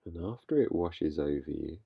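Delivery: calm, unhurried guiding voice with long pauses; guided meditation. Real, not acted. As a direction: gently lead listeners into stillness, leaving space between phrases. Leads a meditation.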